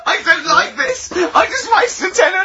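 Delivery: high, slow, squealing laugh-cry; laughing and whining. Real, not acted. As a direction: whimper through giggles. Squirming while feet are touched.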